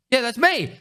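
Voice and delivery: high, upbeat confirmation; pleased recognition. Retold or imitated. Streamer recognised by a stranger, pleased confirmation. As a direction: answer brightly to your name.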